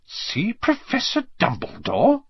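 slow, high, incredulous question; suspicious repeat. A narrator's reading. McGonagall repeating a fishy request, suspicious. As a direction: draw it out, rising with suspicion. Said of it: "as though this was a very fishy thing".

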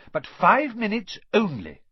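high, loud stipulation; starchy nurse's warning; firm matron. A narrator's reading. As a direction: lay down the limit strictly. Matron sets a strict limit.

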